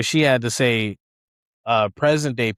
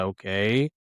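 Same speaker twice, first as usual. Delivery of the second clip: softer, hesitant okay with rising end; awkward; 5 semitones lower, 5 dB quieter than usual. Retold or imitated. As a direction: play along uncertainly, voice lowered. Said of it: "Ned obviously thinks this is awkward".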